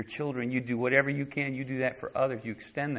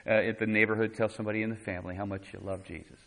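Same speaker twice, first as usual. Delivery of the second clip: ordinary talk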